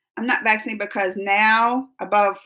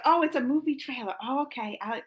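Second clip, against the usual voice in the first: ordinary talk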